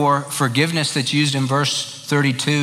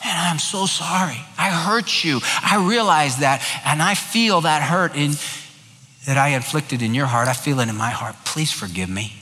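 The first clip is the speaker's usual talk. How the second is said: mean every word, let it sink to a hush; heartfelt apology. Acted. Offender begging the one he hurt for forgiveness, sincere and fading. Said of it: "there has to be from the heart".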